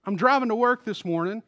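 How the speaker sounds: ordinary talk, nothing marked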